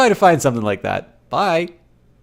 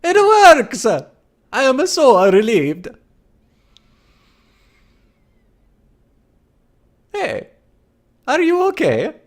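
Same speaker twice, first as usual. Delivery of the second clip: high, hammed-up Italian relief; Italian accent; 5 dB louder, slower than usual. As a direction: cry out in a swooping mock Italian accent. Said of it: "More so for the accent".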